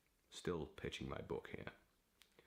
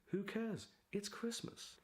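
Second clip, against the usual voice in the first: much higher, gleeful squeal of glee; giddy excitement. Real, not acted. Writer waving off critics of his Christmas book, giddy and high. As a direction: toss it off with giddy delight.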